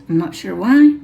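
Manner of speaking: hoarse, voice giving out; strained, cracking voice; losing voice. Real, not acted. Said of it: "I'm losing my voice".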